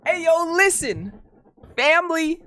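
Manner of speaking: hyped greeting. Real, not acted. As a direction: pump up the fam.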